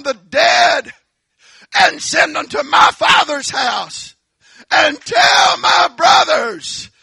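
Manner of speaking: rich man pleading from hell. Acted. Rich man in hell begging Father Abraham, shouting in anguish. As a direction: beg across the gulf for his brothers. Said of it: "he remembers the five brothers".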